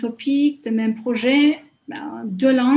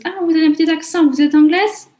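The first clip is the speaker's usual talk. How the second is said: quick, higher voice of a curious stranger; retelling a stranger in the street, lightly imitated, higher and fast; curious passer-by; 6 semitones higher, 6 dB louder, faster than usual. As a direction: mimic a surprised passer-by asking, higher and quick. Passer-by asking about her accent.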